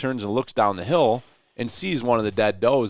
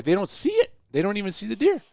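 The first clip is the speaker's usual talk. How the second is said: pitched way up in disbelief; can't believe they missed it; incredulous; 11 semitones higher than usual. Retold or imitated. Disbelief watching hunters miss deer.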